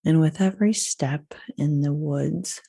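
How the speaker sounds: unhurried visualisation in spaced-out phrases; guided meditation. Acted. Leads woods visualisation.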